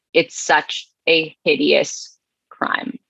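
normal talking voice

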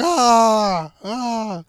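hammed-up pleasure sounds; mock moan.

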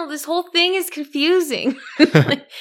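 high, amused voice with laughs breaking in; laughing confusion. Real, not acted. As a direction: laugh at how confusing it all is. Co-host laughing at confusing plot.

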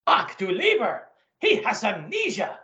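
put-on gruff German officer, German accent; comic-book Nazi colonel, German accent. German colonel voiced by host.

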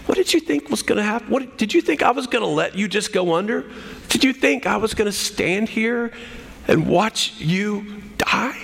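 high, incredulous questioning, kindly meant; unscolding disbelief. Acted. Jesus to sinking Peter, not mad, incredulous. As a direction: ask in amazed disbelief, without anger. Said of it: "he's not scolding him".

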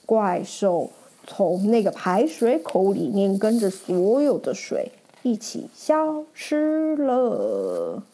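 plain reading voice

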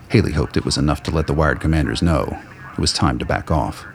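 ordinary delivery

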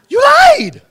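high, loud, sudden cry of you lied; shouted accusation. Real, not acted. Preacher calls out the two-faced lady, shouting. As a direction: call out the liar at full voice.

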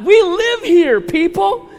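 loud, high, thrilled proclamation; excited shouting. Real, not acted. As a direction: shout it out with pride. Pastor hyping congregation about home.

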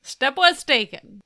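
disbelieving echo; incredulous echo. Retold or imitated. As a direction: repeat it back, stunned and loud. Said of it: "echoes the line".

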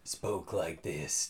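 low, slow villain voice; over-the-top villain. Shown as a demonstration. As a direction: drop into a stock villain's register. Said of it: "over-the-top villainous".

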